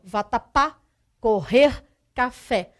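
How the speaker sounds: "vatapá, correr, café", each punched on its last syllable; final-syllable stress. Shown as a demonstration. Teacher gives oxytone examples, last syllable stressed in "vatapá, correr, café". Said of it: "a última sílaba é a mais forte".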